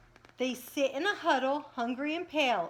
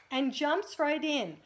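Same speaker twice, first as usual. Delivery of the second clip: plain reading voice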